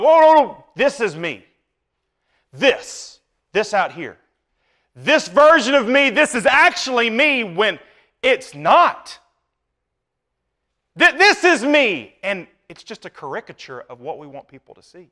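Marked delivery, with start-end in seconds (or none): 0.0-7.4 insistent pretender. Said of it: "We stretch out before they get there and be like". 11.0-12.1 mock insistent pretender. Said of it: "be like".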